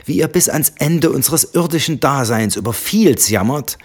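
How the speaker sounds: mock their endless moaning; scornful. Wife sneering at husband's family, scornful.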